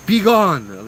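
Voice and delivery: high, loud banishing shout; imitating a yelling spirit voice; yelled command. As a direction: yell it to drive someone off. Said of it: "these are words that are being yelled".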